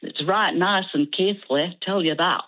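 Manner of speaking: put-on Keighley, Yorkshire accent. Shown as a demonstration. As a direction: slip into broad Yorkshire dialect. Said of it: "learn to speak like that".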